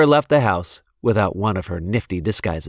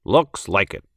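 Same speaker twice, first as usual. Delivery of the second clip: a hint of a dry dad; understated father voice; lightly voiced father; 3 semitones lower than usual. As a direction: answer wryly as the father. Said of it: "said Chester's father".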